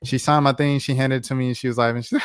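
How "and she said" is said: ordinary delivery, nothing marked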